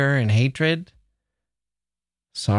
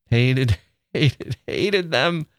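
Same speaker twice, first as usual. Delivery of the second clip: laughing; 2 semitones higher, slower than usual. Real, not acted. Laughing at own hatred.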